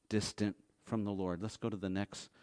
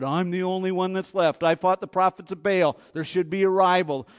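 complaining prophet; 6 semitones higher, 10 dB louder than usual. Retold or imitated. Elijah complaining, voiced loud.

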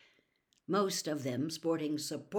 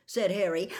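usual speaking voice

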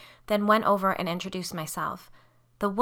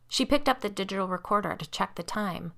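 usual speaking voice

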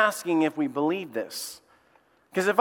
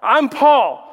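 voicing Paul; 6 semitones higher, 7 dB louder, much slower than usual. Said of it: "He says".

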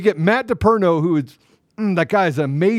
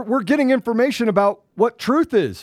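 usual speaking voice

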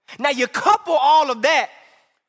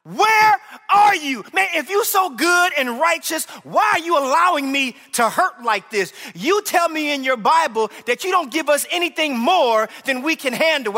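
loud, raw shouting at God, higher; anguished cry to God; 5 semitones higher, 3 dB louder than usual. Retold or imitated. Hurting believer shouting at God in anguish. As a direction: cry out in anger and hurt. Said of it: "you kind of been like".

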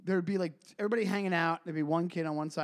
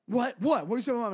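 high, louder indignant what; riled-up kid; 7 semitones higher, 5 dB louder, faster than usual. Retold or imitated. Kid hearing his mom was insulted, high and indignant. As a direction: bristle at the insult. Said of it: "another kid would go".

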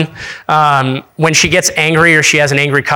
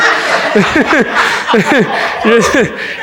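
big high laugh; 7 semitones higher than usual. Real, not acted. Laughing at his own advice.

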